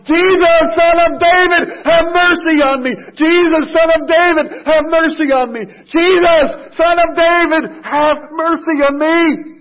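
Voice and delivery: high, loud desperate cry; screaming plea. Retold or imitated. Blind beggar screaming to Jesus over the crowd. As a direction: scream it from the top of your lungs. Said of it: "he screams out of the top of his lungs".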